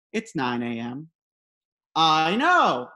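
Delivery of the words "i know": loud reply. Retold or imitated. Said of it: "my dad replied".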